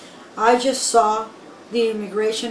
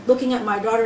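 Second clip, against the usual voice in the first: usual speaking voice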